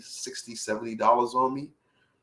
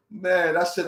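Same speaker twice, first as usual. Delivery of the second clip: normal talking voice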